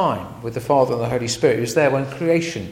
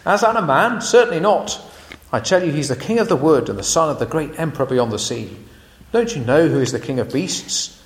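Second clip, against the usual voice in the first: louder, indignant English beaver; BBC Beaver impersonation; 4 dB louder than usual. Acted. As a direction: scoff at the very idea, then proclaim. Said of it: "my B B C Beaver impersonation".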